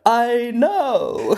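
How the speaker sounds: very high, drawn-out impression; hammed-up high-pitched reply; Tom Hanks. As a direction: stretch it out in a squeaky voice. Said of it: "And he's like".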